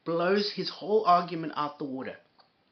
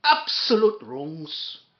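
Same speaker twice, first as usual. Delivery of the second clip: drawn-out, louder Scottish mimicry; mock Scottish accent; 5 dB louder, much slower than usual. Host parroting the clip's speaker, mock Scottish accent. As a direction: stretch it out in a mocking Scottish accent.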